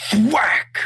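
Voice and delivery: high loud thwack sound effect; sharp cry of thwack; loud imitated thwack. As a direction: smack the word out like a stick hit. Imitates the bamboo hit.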